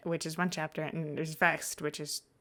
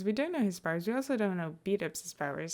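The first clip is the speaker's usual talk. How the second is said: ordinary delivery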